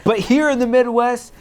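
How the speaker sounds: loud, rising to a mock macho boast; Midwestern talk. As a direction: ham up the Midwest truck-guy bravado. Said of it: "Excuse me from my Midwestern talk".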